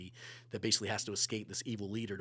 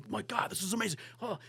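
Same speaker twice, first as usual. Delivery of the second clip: much higher, gushing excited voice; mock gushing executive; 10 semitones higher, faster than usual. Pitching to CBS, execs gush, much higher excited. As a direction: play a thrilled TV exec raving. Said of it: "I couldn't even get two words in".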